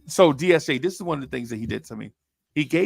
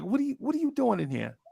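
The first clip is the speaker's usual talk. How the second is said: much higher and fast; retold suspicious question, pitched up; mock challenge; 11 semitones higher, faster than usual. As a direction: needle him like he doesn't belong.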